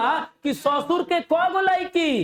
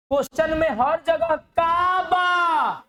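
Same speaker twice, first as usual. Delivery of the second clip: high, slow shout with Kaaba stretched out; 5 semitones higher, slower than usual. Real, not acted. Teacher shouting Kaaba to make students repeat. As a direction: bellow it to the class, slow and high.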